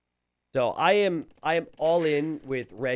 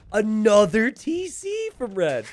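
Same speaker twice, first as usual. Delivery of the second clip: excited announcing; 8 semitones higher, 7 dB louder, slower than usual. Real, not acted.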